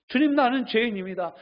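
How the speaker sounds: 주님 cried out high and loud; loud confession. Shown as a demonstration. Worshipper crying out to the Lord, loud confession. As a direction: call out 주님 in earnest. Confession repeated, second louder.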